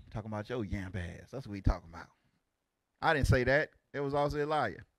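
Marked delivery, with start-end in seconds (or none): 0.1-2.1 muttered aside. Real, not acted. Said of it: "I was about to use a word".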